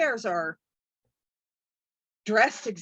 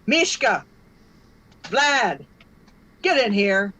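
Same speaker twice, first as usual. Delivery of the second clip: louder, a boss summoning his goons; calling out; 6 dB louder, slower than usual. Acted. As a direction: bark names across the room. Boss calls henchmen.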